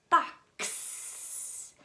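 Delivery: box with the final ks sound hissed out; box, stressing the final ks; box, whispered ks ending. Shown as a demonstration. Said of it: "You hear the X sound at the end".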